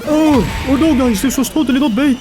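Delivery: high, loud hammed-up panic; pretending to panic while baiting the enemy; mock panic. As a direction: sell the fake panic as bait. Fake panic, obvious bait.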